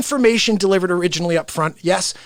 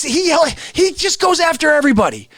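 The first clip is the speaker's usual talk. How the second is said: normal talking voice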